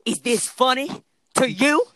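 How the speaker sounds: high, loud, halting mock challenge; stern teacher confrontation. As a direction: imitate an indignant teacher, pausing mid-question. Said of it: "he goes up to".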